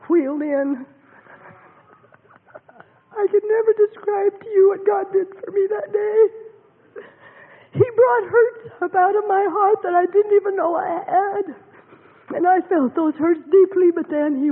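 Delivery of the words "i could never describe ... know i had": higher, voice breaking with emotion; overcome, near tears; emotional, breaking voice; tearful. Real, not acted. Tearful testimony of healing.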